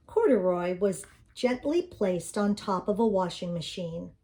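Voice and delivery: plain reading voice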